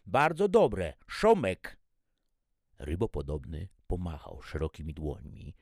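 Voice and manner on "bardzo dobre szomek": high, loud translator voice; eager alien exclamation. A narrator's reading. Aliens praising szomek to the cook through translators, loud and eager. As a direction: exclaim it loudly, pitched way up.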